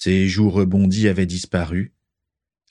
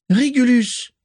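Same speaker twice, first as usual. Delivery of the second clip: calling out; slower than usual. A narrator's reading. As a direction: call his name from afar. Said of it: "appela-t-elle".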